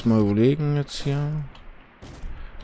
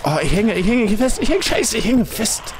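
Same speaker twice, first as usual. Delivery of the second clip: panicked high shouting; frantic, stuck and yelling; being chased; 11 semitones higher, 6 dB louder than usual. Real, not acted.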